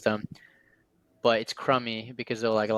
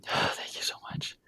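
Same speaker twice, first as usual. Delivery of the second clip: whispered thanks; 7 dB quieter than usual. Retold or imitated. Quoting relieved lady after forgiveness.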